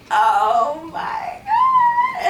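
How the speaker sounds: long, high wailed cry, drawn out; horrified wail. Retold or imitated. Speaker seeing the dog hit by a car, horrified wail. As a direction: wail it high and very slowly, as if bursting into tears. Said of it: "like, bursting into tears".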